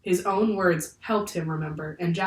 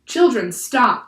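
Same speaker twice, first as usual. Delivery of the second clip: slow, loud, raised order; shouted command; 5 semitones higher, 7 dB louder, slower than usual. A narrator's reading. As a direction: shout to halt them. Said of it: "the professor shouted".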